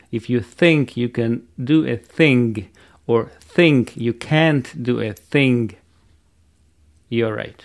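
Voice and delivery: slow, th in every THINK and THING; correct th. Shown as a demonstration. Teacher demonstrating the quote, slow careful th in THINK and THING. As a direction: take it slowly, tongue between the teeth on THINK and THING. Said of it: "правильный и неправильный вариант".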